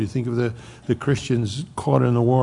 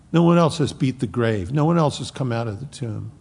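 ordinary talk

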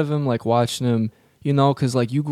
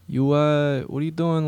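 usual speaking voice

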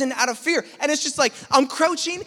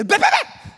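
high staccato mock gunfire; imitated gun bursts; paintball gun noise; 13 semitones higher than usual. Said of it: "that's the sound it makes".

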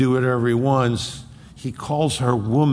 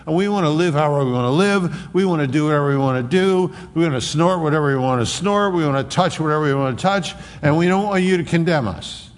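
higher and fast, breezy self-indulgent voice; mocking the culture; 4 semitones higher, faster than usual. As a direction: toss it off like someone who wants no rules.

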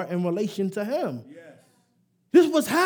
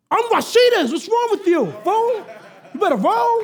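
much higher, sassy mock telling-off; sassy warning; 8 semitones higher than usual. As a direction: put a rival in her place, teasing. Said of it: "I'll be like".

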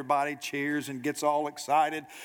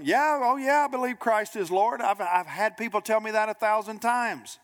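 much higher, breezy mock agreement; glib assurance; 7 semitones higher, 3 dB louder than usual. Churchgoer assures the preacher they believe, glibly. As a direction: imitate someone brushing off the question.

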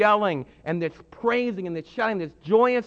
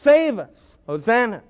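crowd yelling; 3 semitones higher, 5 dB louder than usual. Retold or imitated. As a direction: cry out for rescue. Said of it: "the crowd's yelling to Jesus".

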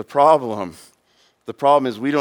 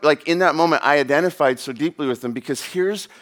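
normal talking voice

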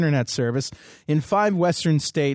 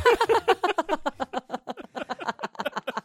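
laughing; 13 semitones higher, 4 dB quieter than usual. Real, not acted. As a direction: burst of high laughter. Host breaks up after joke.

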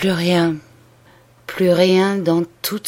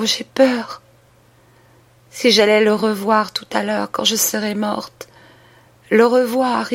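raised, pleading voice of a dying woman; dying sister's confession; 4 semitones higher than usual. Acted. Dying woman begs her sister's pardon, pleading and raised.

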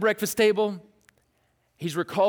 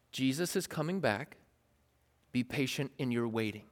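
calm counsel; 6 semitones lower, 9 dB quieter than usual. Pretend. James writing to Christians.

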